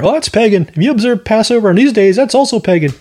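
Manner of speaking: mock church Karen. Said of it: "church Karen out there".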